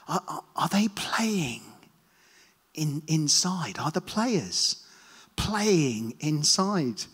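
slow, unvoiced whisper. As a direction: whisper it slowly, timidly. People asking at the door.

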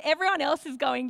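ordinary talk, nothing marked

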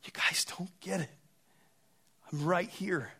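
hushed whisper, pleading with the disciples; imagined Jesus, whispering that they don't get it; whispered plea. Retold or imitated. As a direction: lean in and whisper it urgently. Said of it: "it's like Jesus saying".

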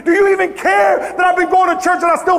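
insistent litany building to a high shout; impassioned preacher acting out desperate prayer; anguished challenge to God. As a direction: cry out each complaint harder, voice climbing.

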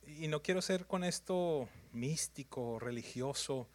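usual speaking voice

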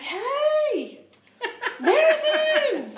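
high, slow, drawn-out call with a laugh; excited shout. Retold or imitated. Imitating the little guy shouting at Americans, excited. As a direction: hail them like an overjoyed fan. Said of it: "He was so excited".

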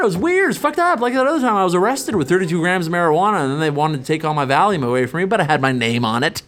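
ordinary talk

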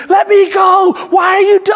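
high, loud shouted plea; high-pitched yell of a struggling kid; screaming child. Retold or imitated. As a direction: imitate a child yelling to be released. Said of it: "he's screaming".

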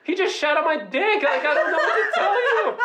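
high, incredulous self-defence; exasperated protest. Retold or imitated. Answering his family's angry emails, exasperated and high. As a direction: protest it with rising pitch, half laughing.

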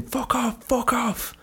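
high-pitched repeated mock scream. Surfer screaming at the shark over and over, high scream. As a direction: shriek it high at the shark, twice. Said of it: "I just kept screaming".

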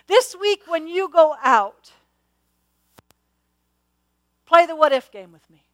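loud, rising preacher's challenge to the church; full-force preaching. Real, not acted. To the congregation, urging them to believe, full-force preaching.

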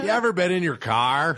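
hammed-up clueless comic shouting; shouting out things. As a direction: holler it at an imaginary crowd. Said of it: "Just shouting out things".

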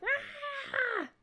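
very high squeaky cat complaint, slowing at the end. Retold or imitated. Host voicing the cat scolding him, high squeaky cat voice. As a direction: voice the cat whining back at you. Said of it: "He's like".

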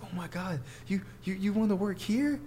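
high-pitched starstruck disbelief; imitated freak-out. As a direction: mimic a cashier freaking out, rising to a question. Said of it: "She just started freaking out".

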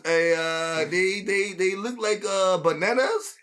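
high, hammed-up Mexican accent, laughing; a joking Mexican caller on the phone; exaggerated comic Mexican accent; mock Mexican accent. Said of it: "That's my Mexican voice".

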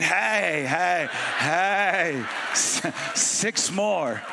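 hammed-up celebration, stretching the words out; mock triumphant cheer. Driver praying for green lights, mock triumphant cheer.

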